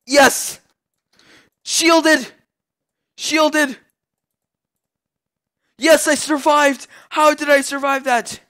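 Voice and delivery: very high, loud shrieks of joy; real ecstatic yells; ecstatic shouting. Real, not acted. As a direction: scream with disbelieving delight. Narrow survival in fight.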